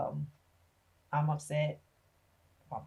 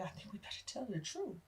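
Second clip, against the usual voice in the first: hushed confession; 4 semitones higher, 10 dB quieter than usual. Retold or imitated. Mother quietly tells the father they must confess. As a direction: let it slip out under your breath. Mom speaks low to dad.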